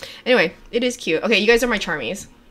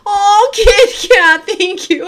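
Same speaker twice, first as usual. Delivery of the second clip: high, loud, drawn-out thanks; overwhelmed squeal; 11 semitones higher, 9 dB louder, much slower than usual. Real, not acted. Streamer startled by Kit Kat's alert, overwhelmed squeal. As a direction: squeal with gratitude, slowly.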